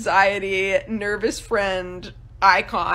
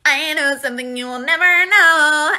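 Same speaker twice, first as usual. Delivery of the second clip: sly teasing voice, getting louder; smug secret; 3 semitones higher, 4 dB louder than usual. Retold or imitated. Caesar to his captors, smug and teasing. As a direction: gloat over a secret.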